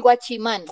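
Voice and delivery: guachimán as Spanish gua-chi-MAN; pronunciación hispanizada. Shown as a demonstration. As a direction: say guachimán in three Spanish syllables. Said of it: "No se dice guachimán". (guachimán a la española, acento final)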